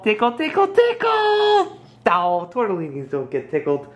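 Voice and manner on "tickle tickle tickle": tickle tickle climbing high; rising playful squeal. Acted. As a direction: build up to a squeak.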